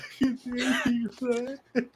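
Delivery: high wailing sob-laugh, hammed up; crying teacher. Teacher sobbing, played for laughs. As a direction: blubber like the weeping teacher. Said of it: "and he's like".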